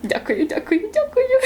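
high, laughing thank-yous; delighted, laughing gratitude. Real, not acted. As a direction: giggly thank you.

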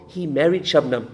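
soft, low delivery of "he married Shabnam"; understated punchline. Acted. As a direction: drop the punchline quietly and low. Punchline first given softly.

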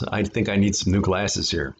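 usual speaking voice

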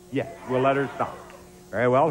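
ordinary talk

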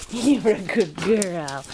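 low, slow tender praise. Real, not acted. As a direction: soothe the dog with slow words.

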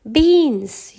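high, loud, outraged yell. A narrator's reading. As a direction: shout it in disbelief. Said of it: "yelled Donald".

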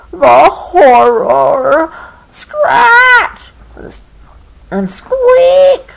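loud, drawn-out horror with high shrieked sound words; dramatic story horror. A narrator's reading. Reader breaks the story's silence with sudden noises, mock-horrified shrieks.

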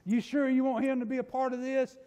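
ordinary talk, nothing marked

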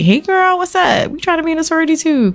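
put-on high, bright sorority-girl hello; high chirpy girly voice; bubbly greeting.

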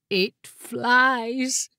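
drawn-out, strained awe; struggling to speak. A narrator's reading. The chair managing at last to answer, overcome. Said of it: "it managed at last".

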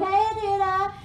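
high, light mock hello; singsong greeting. Friend greets the boyfriend.

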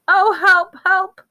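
high, loud cries for help; panicked high-pitched begging; frantic. A narrator's reading. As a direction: plead frantically, voice shooting up. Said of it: "Hermione whispered frantically".

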